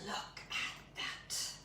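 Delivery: breathy whisper; whispered aside. Real, not acted. Baker admiring the mix, hushed whisper. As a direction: hush it in awe.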